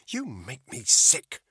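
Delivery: loud, slow, venomous spit of words; woman spitting contempt at the agent; spat. A narrator's reading. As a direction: spit it at him in disgust. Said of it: "Bethany spat".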